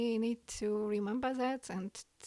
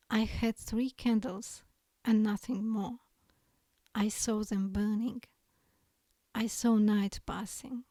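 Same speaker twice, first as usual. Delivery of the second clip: poem reading; 3 dB louder than usual. Acted. Reads her poem aloud.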